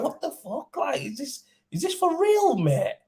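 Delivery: high-pitched, hushed incredulity rising sharply; retold disbelief. Tradesman recalling reading the customer's message, incredulous. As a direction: relive the shock, voice climbing high. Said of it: "I could not believe it".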